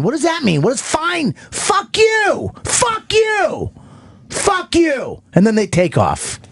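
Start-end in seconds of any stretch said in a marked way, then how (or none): 0.0-5.2 acted furious outburst. Pilots' coffee argument blows up into cursing, mock furious. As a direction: pitch climbing into a hammed-up squeal. Acted quarrel climaxing in curses.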